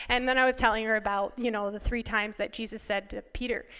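usual speaking voice

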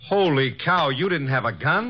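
stunned surprise. Acted. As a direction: blurt it in disbelief.

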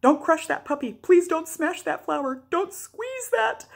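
high, loud pleas climbing to a drawn-out cry; mock parental shouting. Mother translating her warnings to her toddler, mock shouting. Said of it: "The translation for that was".

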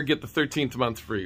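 usual speaking voice

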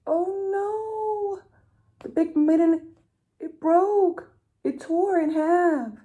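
the mitten bursts apart; alarmed. Acted. Animals cry out as the mitten tears, high.

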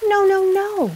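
high frightened protest; sheep crying out. A narrator's reading. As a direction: wail the refusals as a scared sheep. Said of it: "the sheep cried".

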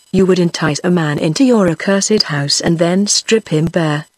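plain reading voice

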